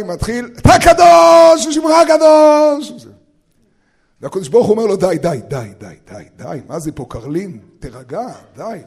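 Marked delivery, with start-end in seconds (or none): 0.6-3.1 loud, high, drawn-out prayer cry; shouted prayer. Acted. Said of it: "ובמקום לצעוק "אחריי". 5.0-8.8 low, soft calming-down; hushing. Acted. God hushes the shouting leader.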